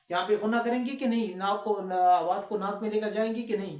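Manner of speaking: ordinary delivery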